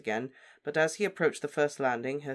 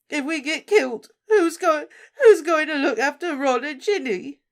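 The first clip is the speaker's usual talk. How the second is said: high, loud, sobbing, stammering; crying; 14 semitones higher, 10 dB louder than usual. Acted. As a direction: pour it out through sobs. Molly's tearful outburst.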